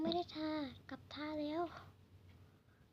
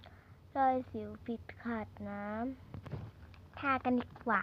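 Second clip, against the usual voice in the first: slow drawn-out; 4 dB louder, much slower than usual. Acted. Stretched ad-style pitch.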